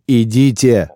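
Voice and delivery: a sudden loud shout. A narrator's reading. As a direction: yell it out as an order. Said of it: "закричал он".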